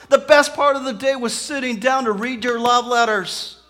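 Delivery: high, loud, driving preacher's delivery; fervent sermon crescendo with pounding repetition; impassioned preaching. Real, not acted. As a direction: preach it at full force, celebrating. Preaches at full force.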